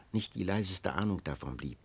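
ordinary delivery

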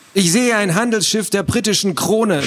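high, excited lookout cry; enthusiastic call. Acted. Boy playing pirate lookout spots a ship, enthusiastic call. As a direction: call out the sighting with delight. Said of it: "rief er begeistert".

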